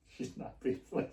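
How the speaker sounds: very soft, almost whispered aside; retold secret, barely voiced; hushed aside. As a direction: confide it under your breath. Retold aside about angry boss.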